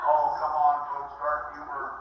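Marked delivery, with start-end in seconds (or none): none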